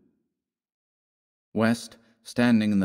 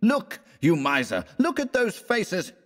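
high-pitched indignant exclamation; crying out; 11 semitones higher than usual. A narrator's reading. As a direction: cry it accusingly, pitch leaping up. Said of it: "cried West".

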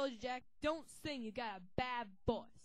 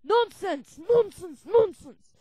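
loud, high repeated outburst; indignant; 6 semitones higher, 15 dB louder, slower than usual. Acted. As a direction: bark it three times. Professor rejects.

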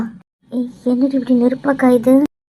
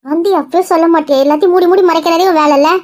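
louder, higher scolding; scolding mother; 5 semitones higher, 7 dB louder than usual. Acted. Mother scolding her sick daughter for hiding things, loud.

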